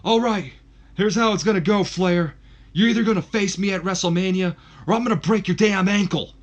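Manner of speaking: loud, high snarling threat, shouted; bully him into the match; menacing threat. Acted. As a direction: Kurt Angle shouting a threat into the mic. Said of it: "Kurt goes on to say".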